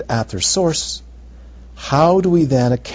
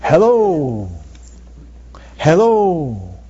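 thespian voice; 2 semitones higher, much slower than usual. Shown as a demonstration. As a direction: boom it like a stage actor. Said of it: "I have a thespian voice".

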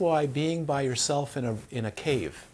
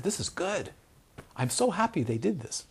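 higher, delighted voice; happy reassurance in a raised voice; retold delight; 6 semitones higher than usual. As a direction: lift the pitch, pleased for them. Retells delight at student's trouble.

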